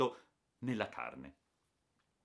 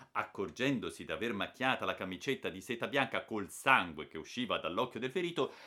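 plain reading voice

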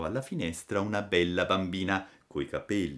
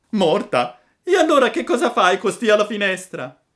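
high, very loud puppet voice; astonished shout; 12 semitones higher, 10 dB louder than usual. A narrator's reading. Puppet questions dead girl at window, astonished shout. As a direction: fire the question back in loud disbelief.

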